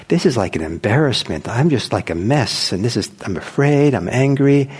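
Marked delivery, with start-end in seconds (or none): none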